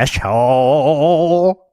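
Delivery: very drawn-out mock-American "Asshole"; bad American accent. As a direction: stretch it out in a weird American accent. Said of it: "talk about my American accent".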